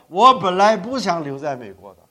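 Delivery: ordinary delivery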